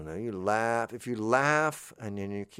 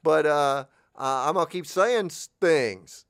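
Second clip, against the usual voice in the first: mock address to audience; 6 semitones higher, 4 dB louder than usual. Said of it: "It's like".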